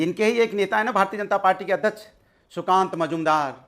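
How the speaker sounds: ordinary talk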